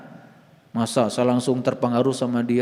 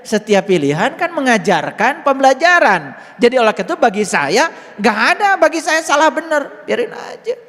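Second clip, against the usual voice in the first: forceful preaching; 9 semitones higher, 8 dB louder than usual. Acted. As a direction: proclaim it to the whole room. Preaching that nothing is wrong.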